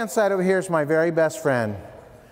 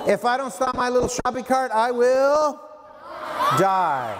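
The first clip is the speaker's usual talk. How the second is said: dramatic climax; 4 semitones higher than usual. Retold or imitated.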